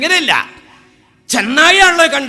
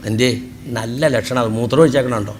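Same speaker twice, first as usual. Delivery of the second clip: lower, sly dealer's sales pitch; cattle broker; 14 semitones lower, 4 dB quieter than usual. Retold or imitated. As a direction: talk the buyer into the deal, pitched well down. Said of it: "അപ്പോൾ broker പറയേ".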